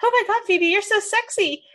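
quoting Phoebe's flirty French friend, gushing. Retold or imitated. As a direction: swoon over it in mock excitement. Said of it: "She's like".

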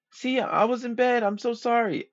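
put-on very high girly apology; sweet girlfriend. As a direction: squeak it out like a sorry girlfriend.

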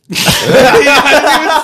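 put-on high old lady cackle; granny cackle. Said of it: "my granny voice".

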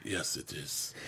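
put-on low, soft African accent. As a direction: answer in a deep African accent, quietly. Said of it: "Your African accent".

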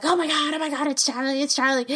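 high-pitched, excited retold freak-out; freaked-out fangirl. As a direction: gush it like a starstruck fan reliving the shock. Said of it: "and I freaked out".

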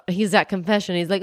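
ordinary talk, nothing marked

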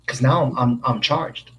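ordinary talk

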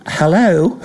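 high, dry drawn hello; understated surprised hello; Benaud's hello. Retold or imitated. Benaud reacting to Gilchrist's six, dry surprise. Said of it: "Richie Benaud go".

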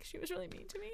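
small, high, soft complaint; mock wounded. Customer imagines leaving a review, mock wounded. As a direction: complain in a tiny hurt voice.

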